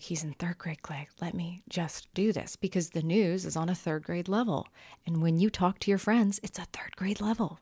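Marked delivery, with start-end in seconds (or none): 0.0-2.5 whispered aside. Retold or imitated. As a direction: hush it to him. Retold whispered aside to husband.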